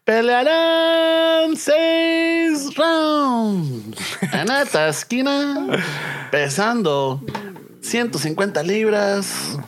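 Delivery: high, drawn-out ring announcer call; lucha libre announcer. Pretend. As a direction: stretch the words like a boxing ring announcer. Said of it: "And then I'll be like".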